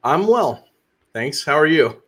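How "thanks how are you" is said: ordinary talk, nothing marked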